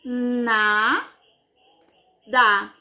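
"Na, da" with a long gap between syllables. Shown as a demonstration. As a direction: break "Na, da" into two separate syllables. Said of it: "quantas vezes a nossa boca abre".

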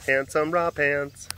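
ordinary talk, nothing marked

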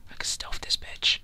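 soft, confident whisper. Real, not acted. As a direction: whisper as you creep up. Sneaking up on an enemy.

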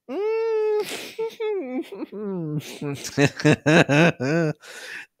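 long high squealing laugh; high wheeze building into laughter; real helpless laughter. Real, not acted. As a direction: lose it in a drawn-out giggling fit. Reader loses it at the dialogue.

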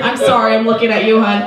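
ordinary talk, nothing marked

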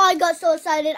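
normal talking voice

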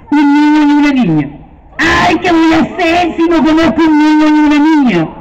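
hammed-up witch worrying aloud. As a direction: play the fretting witch, hurried. Witch voice in told story.

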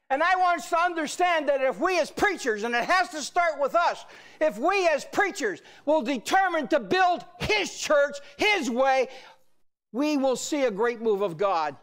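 forceful, driving sermon delivery; full-force preaching. Acted. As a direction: proclaim defiance with every phrase punched.

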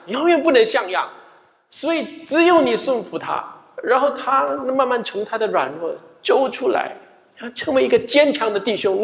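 heated sermon appeal; fervent preaching. Acted. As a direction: press the point home. Preaching builds up.

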